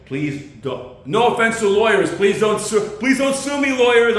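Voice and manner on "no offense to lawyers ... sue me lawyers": high, loud mock begging; pleading shout. Comic begging lawyers not to sue, loud and high. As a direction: beg the lawyers loudly, hammed up.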